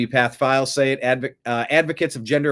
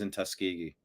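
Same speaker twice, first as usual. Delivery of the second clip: normal talking voice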